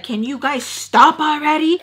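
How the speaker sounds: high, loud irritated outburst; annoyed animosity. Retold or imitated. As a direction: imitate someone fed up with the flirting. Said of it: "Sam got a little animosity towards them".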